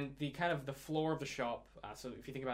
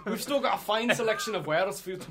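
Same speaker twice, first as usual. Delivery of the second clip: higher, louder salesman's plea; pleading dwarf shopkeeper; 6 semitones higher, 8 dB louder than usual. Acted. Shopkeeper stops customers leaving, eager pleading. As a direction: coax them back in a dwarf voice.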